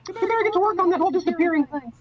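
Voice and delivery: fast, very high cartoon threat; high-pitched cartoon boss, rattled off fast; Mick. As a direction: squeak it out quickly, ending in a giggle. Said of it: "and says".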